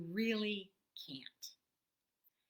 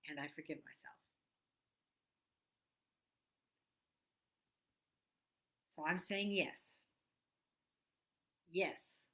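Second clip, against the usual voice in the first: tapping affirmations; 4 semitones lower than usual. Acted. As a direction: speak each yes like a gentle vow. Tapping affirmation script, spoken slowly.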